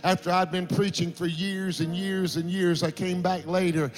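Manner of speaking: retelling the milk crate conversion with rising passion; rising preaching. Acted. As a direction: preach it with growing fervour. Preacher builds the sermon story.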